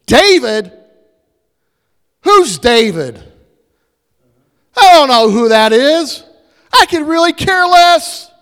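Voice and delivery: high, loud, jeering rant about his land and goods; angry scornful yelling. Retold or imitated. Nabal yelling at David's servants, loud and scornful. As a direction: bellow it like a stingy rich man throwing out beggars. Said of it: "Nabal looks at them and goes".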